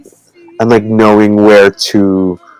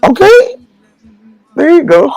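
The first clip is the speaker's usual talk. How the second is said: much higher, bright squeal of praise; delighted praise. Real, not acted. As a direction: cheer the pupil on, pitch jumping up. Praises pupil's correct pronunciation.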